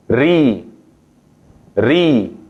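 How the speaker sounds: slow drawn-out ri, teacher demo; Arabic ri. Shown as a demonstration. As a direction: say Ri clearly, vowel i drawn out. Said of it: "son así". (pronunciación árabe de Ri, lenta y clara)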